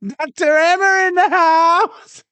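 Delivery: high, drawn-out mock shout; slow, high-pitched playful yell; hammed-up announcement. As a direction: holler it like a hype intro. Hammed-up catchphrase during clip reactions.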